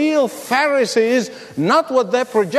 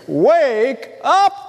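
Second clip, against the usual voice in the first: crying out; 4 semitones higher than usual. Retold or imitated. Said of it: "someone crying out, saying".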